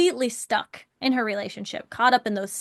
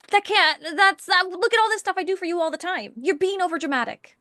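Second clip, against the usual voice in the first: high, sputtering mock indignation; acted defensive outburst; 7 semitones higher than usual. Coach shows partners starting to butt heads, defensive and indignant. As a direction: stumble into a raised-pitch, hurried self-defense. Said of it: "we're starting to butt heads".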